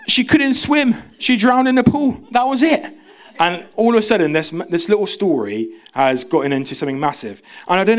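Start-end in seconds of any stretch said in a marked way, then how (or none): 0.1-2.9 high, loud squeaky protest; mock exasperated explaining. Explaining to the church guy, high and exasperated.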